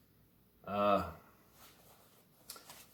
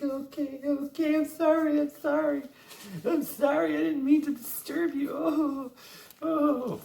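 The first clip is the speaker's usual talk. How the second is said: very high whimpering plea, hammed-up; mock pleading. Beaten old man begging attackers, hammed-up high whimper. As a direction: squeak out a cowering apology. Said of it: "He's like".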